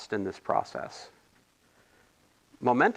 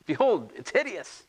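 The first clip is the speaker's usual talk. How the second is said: mock grandeur; 7 semitones higher than usual. Mock drama over the notes.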